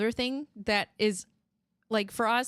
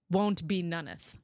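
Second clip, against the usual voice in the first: normal talking voice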